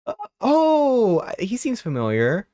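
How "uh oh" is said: high, loud uh oh; startled recognition; sudden realization. Real, not acted. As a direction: yelp as it clicks.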